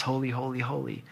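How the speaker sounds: soft, fading "holy, holy, holy"; threefold repetition. Shown as a demonstration. Preacher illustrating the word repeated twice, softly fading. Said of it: "repeated twice".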